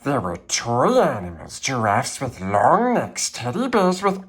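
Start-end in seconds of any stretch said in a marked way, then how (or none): none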